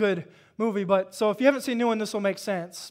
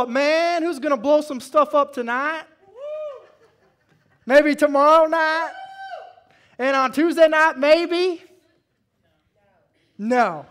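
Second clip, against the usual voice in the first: louder, higher preacher's build; preaching at full force; 7 semitones higher, 9 dB louder than usual. Acted. Preacher firing up the congregation, loud and high. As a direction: drive the point home to the church.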